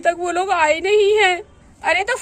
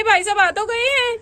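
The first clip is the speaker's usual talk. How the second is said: ordinary talk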